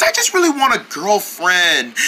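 ordinary talk, nothing marked

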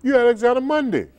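very high, loud mimicked grandma; high-pitched, hollered impression of an old lady; grandmother's exclamation. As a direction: cry out in delighted recognition, imitating grandmother. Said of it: "She said".

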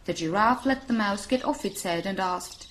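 plain reading voice

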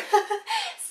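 high, loud laugh breaking out; burst of laughter. Real, not acted. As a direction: laugh at the memory. Laughing at own story.

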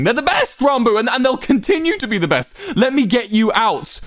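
very high, frantic plea; desperate pleading. A narrator's reading. Wilbur begging to get Ranbu out, desperate pleading. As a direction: plead at the top of your range, stretching the last words.

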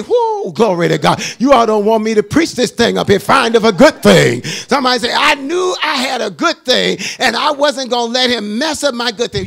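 whoop, then loud exultant preaching; full-force preaching. Acted. Preacher rejoicing over his good wife, loud full-force preaching. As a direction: belt it out to stir up the congregation.

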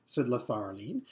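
ordinary delivery

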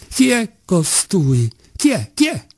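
outrage mounting with each question; rising indignation. Acted. Doctor losing his temper at the boy, rising outraged questions.

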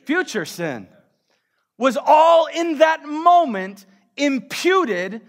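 loud, high, call-and-response preaching; full-force preaching. Acted. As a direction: drive it home to the church.

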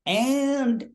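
high, drawn-out cue; director's call. Retold or imitated. Director cueing cast and crew, a high suspended call. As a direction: hold it up high before the take. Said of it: "The director goes".

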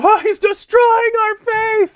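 very high, slow mock wail. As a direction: ham up a despairing cry. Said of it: "they walked out of there".

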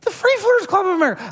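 high, fast disbelieving repeat; mock astonished echo of the club name; incredulous. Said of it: "I don't know who these whack jobs are".

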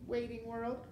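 ordinary delivery, nothing marked

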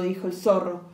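ordinary delivery, nothing marked

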